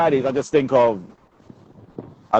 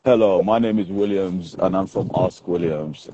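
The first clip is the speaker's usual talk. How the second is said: telephone voice; 9 semitones lower, 4 dB quieter than usual. Acted. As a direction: answer like a call centre. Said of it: "Are you giving us your telephone voice".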